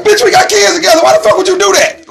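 shocked shriek. Retold or imitated. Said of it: "You like".

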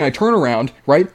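usual speaking voice

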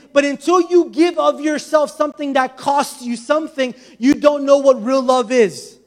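fervent sermon climax, punching key words; forceful, impassioned preacher driving the point home; full-force preaching. Real, not acted. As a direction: proclaim it to the whole congregation.

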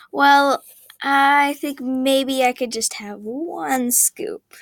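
slow, hesitant backing down; sheepish. Acted. Girl scaling down her order for her mom, sheepish and slow. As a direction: take it back, a little embarrassed. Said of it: "she said sheepishly".

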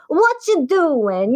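slow, high Italian mother voice; Italian accent. Retold or imitated. Host imitates her mom's Italian accent, slow and high. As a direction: say it like a little Italian mom. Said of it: "authentic Italian accent".

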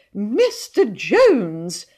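slow, swooping cry of horror; aghast, stretched-out exclamation; shocked gasp. A narrator's reading. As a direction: draw out the name, scandalised. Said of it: "they gasped".

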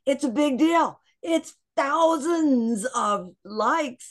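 high, loud, emphatic hype; excited announcing. Retold or imitated. Guest telling friends about TikTok success, excitedly.

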